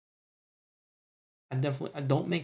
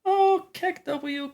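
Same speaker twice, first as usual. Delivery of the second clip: surprised; much slower than usual. Real, not acted. Reacting to chat emote.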